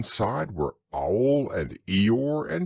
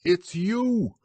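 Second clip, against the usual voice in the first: much higher, fussy protest; fussy Owl; 10 semitones higher than usual. A narrator's reading. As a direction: flap about fussily, high and quick. Owl insists.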